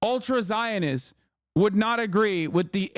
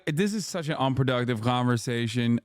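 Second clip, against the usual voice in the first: ordinary delivery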